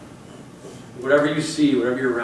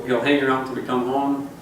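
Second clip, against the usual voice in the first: ordinary talk, nothing marked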